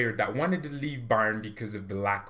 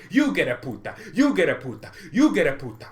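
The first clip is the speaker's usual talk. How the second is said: Oprah-style giveaway; 4 semitones higher, 4 dB louder than usual. Retold or imitated. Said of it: "Oprah Winfrey, he feels like".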